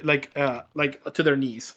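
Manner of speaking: normal talking voice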